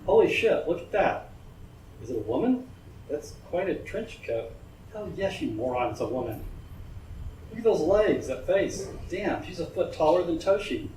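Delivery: a hint of a cocky gang youth; gawking thug. A narrator's reading. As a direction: play the leering street punk. Young thugs gawking at woman.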